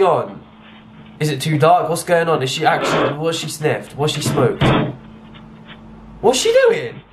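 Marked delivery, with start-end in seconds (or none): 6.2-7.0 shoots way up in pitch, disbelieving; baffled squeal. Real, not acted. Reviewer watching a woman stumble, high astonished squeal.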